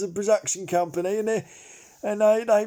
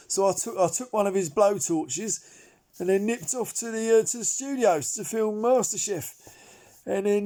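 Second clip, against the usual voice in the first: ordinary delivery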